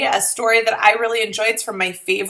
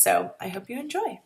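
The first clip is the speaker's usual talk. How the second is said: usual speaking voice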